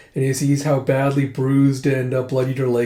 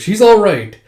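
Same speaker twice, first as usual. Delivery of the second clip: high, loud declaration; emphatic assurance; 7 semitones higher, 9 dB louder than usual. Acted. Companion insisting the princess is safe, emphatic. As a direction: insist on it with full confidence.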